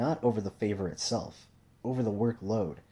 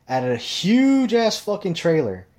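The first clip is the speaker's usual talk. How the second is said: louder and much higher, slowed, stretching each word; exaggerated outrage; 10 semitones higher, 9 dB louder, slower than usual. Ranting to viewers about the boss's huge trailer, loud and incredulous. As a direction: blow the trailer up into something monstrous.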